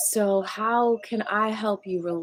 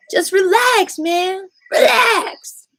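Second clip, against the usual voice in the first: high loud exasperated shout of "relax"; impatient relax; 12 semitones higher, 9 dB louder, slower than usual. Retold or imitated. Host acting someone forcing a knot, shouting impatiently. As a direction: yell it while yanking a tight knot. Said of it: "You're going like this to someone's knot".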